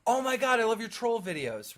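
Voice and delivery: lightly voiced fan; mock excited fan. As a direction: gush like a starstruck viewer. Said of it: "People will see me and be like".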